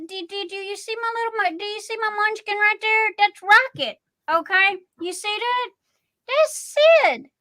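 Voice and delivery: high, fast cooing at her pets; sing-song pet voice, pitched well up; cooing pet talk. Real, not acted. Showing off her pets on camera.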